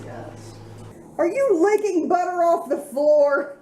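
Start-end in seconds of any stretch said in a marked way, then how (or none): 1.2-3.6 surprised. Real, not acted. Pet licking butter off floor.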